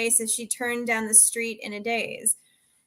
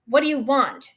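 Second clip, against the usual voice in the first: spat out; 3 semitones higher, 6 dB louder than usual. A narrator's reading. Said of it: "she spit out at him".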